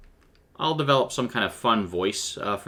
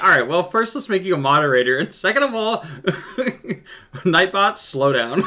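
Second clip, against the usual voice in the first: laughing; 8 semitones higher, 6 dB louder than usual. Retold or imitated. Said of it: "I was like".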